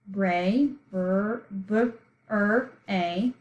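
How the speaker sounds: "bra" then broken into "b, r, a"; sounding it out. Shown as a demonstration. Teacher sounding out the third syllable, sound by sound. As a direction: split "bra" into single letter sounds, pausing between. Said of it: "So let's sound it out".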